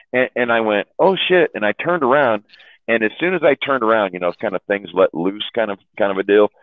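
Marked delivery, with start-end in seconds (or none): 1.0-1.5 high-pitched retold alarm; startled oath. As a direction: act suddenly caught in the act. Caught by motorcycle cop.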